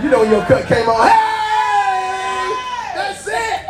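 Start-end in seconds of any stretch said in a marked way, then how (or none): 1.1-3.6 high, hyped-up shout to the organ; excited shout. Real, not acted. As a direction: cheer the music on. Preacher hypes with organist.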